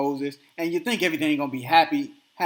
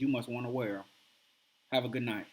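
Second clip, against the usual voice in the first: low, soft, unbothered dismissal; calmest manner; 6 semitones lower, 7 dB quieter, faster than usual. Retold or imitated. Husband calmly dismissing his wife after throwing his pants, quiet cool calm. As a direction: say goodnight quietly, lower and softer. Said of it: "In his calmest manner".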